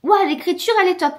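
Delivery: high, excited "Ouah" like a rave review; mock gushing reviewer. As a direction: sell it like an enthusiastic blurb. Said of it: "vous devez voir des fois".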